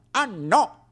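lightly voiced professor objecting; imitating the professor. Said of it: "disse lui".

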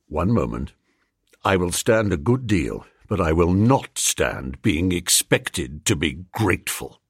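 low, controlled indignation; stern protest. A narrator's reading. Freddy warning his friend, low and stern.

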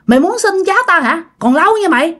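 acted indignant telling-off; angry scolding. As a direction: curse back at a threatening ghost. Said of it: "Bà nội mới chửi nha".

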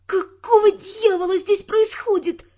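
waking heroine demanding answers, strained hiss. Acted. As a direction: force the words out through a sore throat. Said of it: "прошипела".

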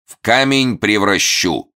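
high, loud threatening snarl; growl. Acted. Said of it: "рыкнул Момус".

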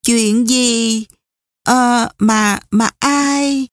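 halting, drawn-out words with hesitant pauses; faltering stammer. A narrator's reading. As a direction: stumble over the question, unsure how to ask it. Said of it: "Nàng ấp úng". (ấp úng, ngập ngừng)

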